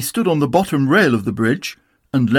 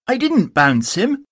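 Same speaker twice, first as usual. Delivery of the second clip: Tigger voice; 9 semitones higher than usual. Acted. Tigger denies.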